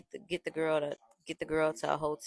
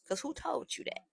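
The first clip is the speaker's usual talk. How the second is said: high, softer mock-puzzled question; feigned innocence; 9 semitones higher, 5 dB quieter than usual. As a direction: play dumb, voice lifted and softened. Playing innocent to boyfriend on phone.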